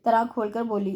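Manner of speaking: ordinary delivery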